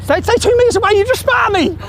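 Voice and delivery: furious shout. Real, not acted. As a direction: scream it at him in outrage.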